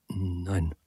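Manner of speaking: soft, wavering no, barely voiced; faltering whisper. A narrator's reading. Minute refusing the money, a soft shaky whisper. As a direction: let the no tremble out quietly. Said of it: "flüstert Minute schwankend".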